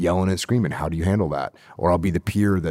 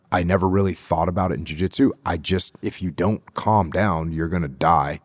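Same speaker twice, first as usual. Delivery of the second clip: normal talking voice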